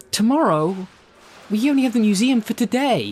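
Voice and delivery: high, loud indignant disbelief; can't believe what he's hearing; outraged actor hearing the museum shoot is cancelled; shocked protest. Real, not acted. Actor shocked by schedule change.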